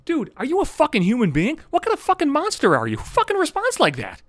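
high, incredulous rant; indignant high-pitched rant; outraged. Real, not acted. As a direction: rail at her in disbelief. Outraged at her reply.

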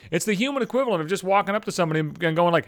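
ordinary talk, nothing marked

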